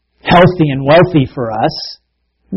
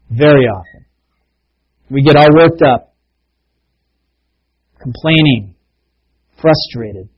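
closing prayer. Acted. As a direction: pray it humbly, word by word.

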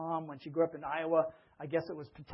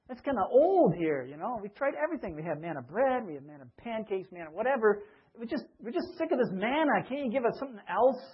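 higher, whiny complaint, hammed-up; mock grumbling Israelites; 5 semitones higher, 3 dB louder than usual. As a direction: whine like tired complainers. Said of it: "They were like".

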